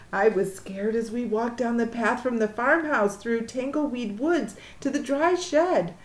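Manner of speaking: ordinary delivery, nothing marked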